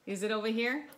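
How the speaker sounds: usual speaking voice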